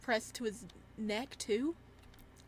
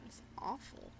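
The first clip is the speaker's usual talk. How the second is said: whispered; 9 dB quieter than usual. Acted. Character cold in tub.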